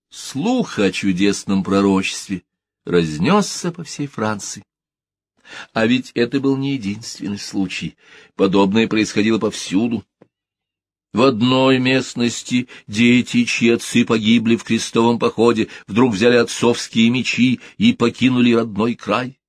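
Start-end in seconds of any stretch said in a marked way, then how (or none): none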